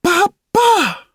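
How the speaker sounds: high, loud cry of joy; joyful cry. A narrator's reading. New puppet calls to his mother, high joyful cry. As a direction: call out to her in delight. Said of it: "I cried".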